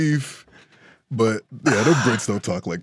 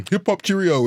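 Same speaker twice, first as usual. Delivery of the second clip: hammed-up posh English voice, pitched up; mock the English with a silly cheerio; mock British accent; 7 semitones higher than usual. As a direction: fake British toff accent. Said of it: "they go".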